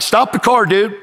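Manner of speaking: retold urgent yell; urgent call. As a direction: call out urgently to halt the driver.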